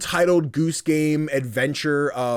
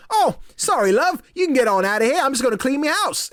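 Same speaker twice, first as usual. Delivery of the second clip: high, louder cheery British bloke; mock British homeowner; 12 semitones higher, 4 dB louder than usual. Gamer voicing the man whose house she fell into, high mock British. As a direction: play the cheery Brit telling you to leave.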